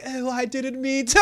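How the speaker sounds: high-pitched whiny-excuse imitation; mock apology. Dying antagonists always apologize, mock apology. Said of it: "they always apologize".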